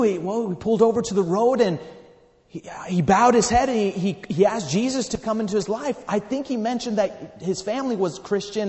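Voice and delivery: loud, high, urgent retelling; impassioned testimony. Retold or imitated. Traveler telling the widow of her husband's conversion, impassioned. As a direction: push it out with mounting fervor. Climax of retold conversion story.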